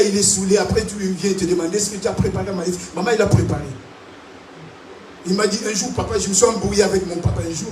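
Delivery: usual speaking voice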